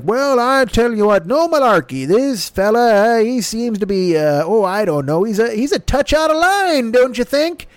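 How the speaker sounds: putter along like a kindly cookie truck driver; folksy old-timer Biden. Retold or imitated. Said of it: "quit talking like the fucking Pepperidge Farm cookie truck driver".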